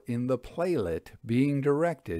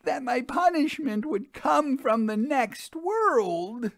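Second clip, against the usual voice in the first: much higher woman's voice; frightened widow; 10 semitones higher than usual. Acted. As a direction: let her dread show. The widow explains her fear.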